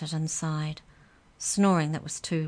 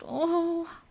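very high startled oh; pained cry; 13 semitones higher than usual. A narrator's reading. Boy hitting the wall, high pained cry. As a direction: gasp out in pain.

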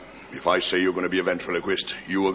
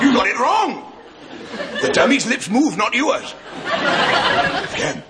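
shouting; 13 semitones higher, slower than usual. Real, not acted. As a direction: bellow at the recruit. Sergeant major bawling out recruit.

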